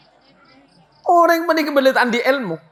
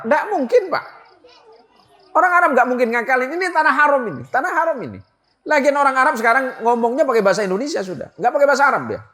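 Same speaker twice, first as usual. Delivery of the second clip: higher, eager voice brushing off the worry; insistent reassurance; 6 semitones higher, faster than usual. Retold or imitated. Kiai telling the pilgrim Arabs won't cheat him, pitched up and eager. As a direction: talk him out of it, pitched up and quick.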